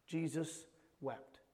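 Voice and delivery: slow, soft and low; hushed solemn repeat. Real, not acted. Preacher repeats the shortest verse to his church, hushed and solemn. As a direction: let the verse sink in quietly.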